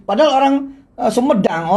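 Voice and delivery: normal talking voice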